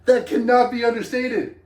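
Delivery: high, loud, laughing enthusiasm; excited laughing. Real, not acted. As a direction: gush through a laugh. Excited about free skiing.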